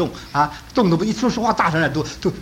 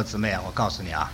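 softer, gently breaking bad news; slow gentle telling; 8 semitones lower, 8 dB quieter than usual. Pastor breaking son's death to grieving mother, gentle and soft. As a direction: ease the news out softly and calmly. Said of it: "我尽量讲得慢，讲得轻".

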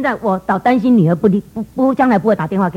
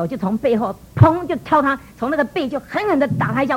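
usual speaking voice

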